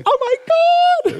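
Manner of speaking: high, slow, shocked "Oh my God". Retold or imitated. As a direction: gasp it out, stunned to learn who he is. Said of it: "She goes".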